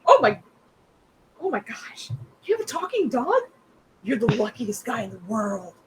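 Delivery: gushing over a talking dog; thrilled gushing. Acted. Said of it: "She's just".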